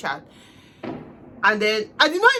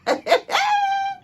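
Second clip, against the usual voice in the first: very high squealing laugh; high shrieking laugh. Real, not acted. Laughing at how his claim was a trap, with a high squeal.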